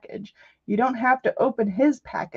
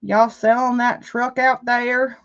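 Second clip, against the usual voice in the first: slow Southern country drawl, a bit louder; country accent; 3 semitones higher, 6 dB louder, slower than usual. Retold or imitated. Country neighbor asking about the old truck, slow Southern accent. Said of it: "didn't sound like a country person".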